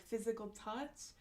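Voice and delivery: ordinary talk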